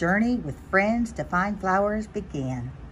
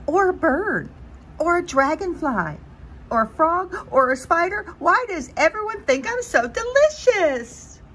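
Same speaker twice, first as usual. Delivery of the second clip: spiral into a squeaky panic; rising panic; 9 semitones higher than usual. Acted. Butterfly lists predators, panicking.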